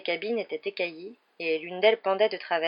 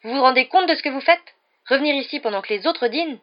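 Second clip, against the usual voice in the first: loud, high reprimand; indignant scolding; 7 semitones higher, 8 dB louder than usual. A narrator's reading. Percy scolds Ron for returning there, indignantly. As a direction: berate them in disbelief.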